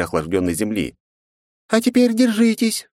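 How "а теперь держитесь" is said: high sung fairy voice; pixie singsong. A narrator's reading. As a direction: sing it out like a fairy. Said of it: "пропел голосок невидимой феи".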